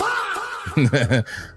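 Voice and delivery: a short high real laugh, softly; amused laugh. Real, not acted. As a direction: laugh it off as if the AI question is absurd. Laughs at being called AI.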